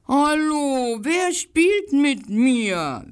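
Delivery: high, slow repeated call of the little dog; the little yellow dog repeating his question, high and slow; little dog calling again. A narrator's reading. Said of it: "wiederholte er seine Frage".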